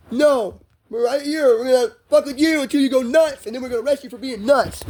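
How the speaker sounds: high, loud mocking imitation of cops; hostile cop. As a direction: hammer out the cops' threat at a high pitch. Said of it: "the cop won't give me that space".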